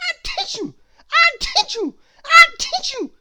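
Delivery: three high, loud sneezes shaped as words. Acted. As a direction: sneeze it out three times, big and sudden. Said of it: "sneezed Mister Sneeze".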